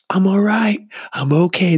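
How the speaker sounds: high-pitched mock cry; squeaky, over-eager reassurance; drunk girl bouncing back. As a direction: imitate a drunk insisting she's fine, way up high. Fallen drunk girl pops up.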